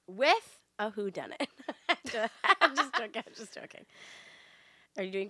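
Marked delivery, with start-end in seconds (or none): 3.3-3.8 whisper. Real, not acted. Takes back whodunit joke.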